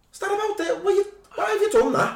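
high-pitched outraged pundit; Jamie Carragher. Retold or imitated. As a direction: shriek at the screen. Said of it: "full Jamie".

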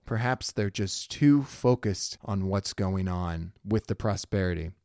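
ordinary talk, nothing marked

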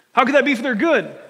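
loud, high-pitched challenge; acted disbelieving outburst; incredulous objection. Voices a doubter's objection.

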